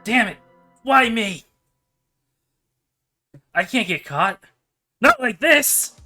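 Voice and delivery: high, frantic panic; panicked villain. Acted. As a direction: cry out in desperate panic.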